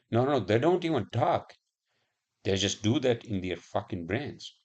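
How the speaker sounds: ordinary delivery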